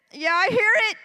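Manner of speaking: finally hearing the radio; amazed realization. Retold or imitated. Mother realizes the noise is real.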